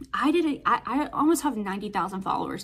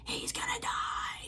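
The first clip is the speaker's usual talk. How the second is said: slow, hushed whisper, as if writing the patient off; grim whisper; 8 dB quieter, slower than usual. Retold or imitated. ICU staff giving up on a patient, in a hushed whisper. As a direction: breathe it like a hopeless verdict. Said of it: "everybody's like".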